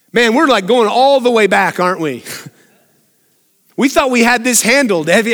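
ordinary talk

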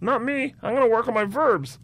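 high, mocking little voice; goody-goody student. Classmate refusing to join train robbery, goody-goody. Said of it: "You're the kind of person that's like".

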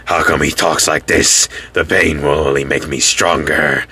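low mock villain voice, a touch menacing; a hint of a dark, solemn anime villain. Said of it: "he just talks like this a lot".

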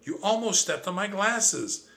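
high, agitated protest; alarmed. Acted. Narrator scolds Trap about his glasses, high alarm. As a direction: scold him, still high from the fright. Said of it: "I shrieked".

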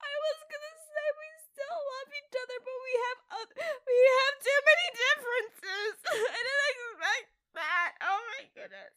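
high, helpless laughing speech; cracking up. Real, not acted. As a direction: talk through laughter, almost crying. Cracks up during divorce bit.

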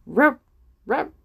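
mock dog bark rup rup; lightly barked dog voice. A narrator's reading. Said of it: "Passou um cão".

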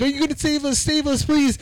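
hammed-up high plea for rescue; panicked passengers. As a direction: squeak it out like scared passengers begging. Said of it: "people are like".

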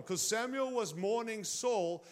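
usual speaking voice

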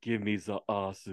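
mimicked Japanese learner's English; Japanese accent. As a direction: say "the ass" as "za assu". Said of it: "Japan generally has with certain words".

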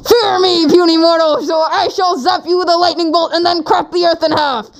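high booming mock deity, hammed up; evil god voice. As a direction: play a wrathful god threatening a mortal. Mock threatening god quote.

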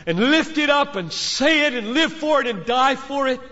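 impassioned preacher calling students to live and die for it; preaching climax. Acted.

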